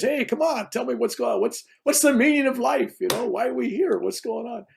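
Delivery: high, eager pleading questions. Pretend. To the rishis, eager high-pitched questions.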